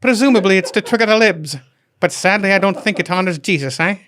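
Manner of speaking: high, loud, laughing fake Irish lady; laughing through a failed Irish lady voice; bad Irish accent. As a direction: ham up a terrible Irish brogue, cracking up. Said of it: "I don't even know what that accent was".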